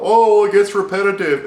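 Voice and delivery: mock the whiners; hammed-up gamer whine; mock complainer. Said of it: "that's what people are gonna complain about".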